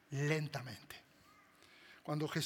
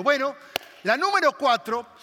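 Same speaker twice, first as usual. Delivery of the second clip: loud, higher mock opener; mock announcement; 6 semitones higher, 9 dB louder than usual. Shown as a demonstration. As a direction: announce it like a list item. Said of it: "Podría haber empezado diciendo". (anuncio fuerte y exagerado)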